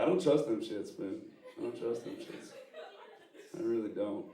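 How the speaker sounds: ordinary delivery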